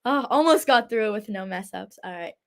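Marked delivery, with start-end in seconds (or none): none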